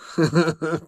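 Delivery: short laugh at something gross; real brief giggle; grossed-out chuckle. Real, not acted. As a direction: chuckle through mild disgust. Handling gross anchovy paste.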